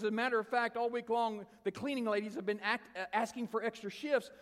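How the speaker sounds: high, insistent, worked-up telling; imitated high-pitched rush of praise; emotional gushing. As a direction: gush as an amazed cleaning lady. Retold lady gushes about the youths.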